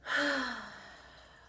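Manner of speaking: long, airy sigh out through the mouth; deep exhale. Shown as a demonstration. Instructor demonstrating deep breaths to listeners, long exhaled sigh. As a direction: let out a slow cleansing breath. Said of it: "breathe out of the mouth".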